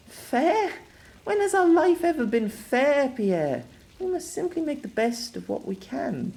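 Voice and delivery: very high, anguished woman's voice; pained, resigned woman. Acted. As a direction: answer his protest in a high, pained voice.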